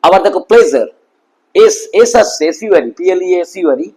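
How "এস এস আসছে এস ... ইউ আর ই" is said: ordinary talk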